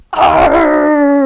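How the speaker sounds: loud, drawn-out angry pirate roar; angry pirate "arrr". Shown as a demonstration. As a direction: roar a furious mock arrr. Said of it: "in an angry pirate voice".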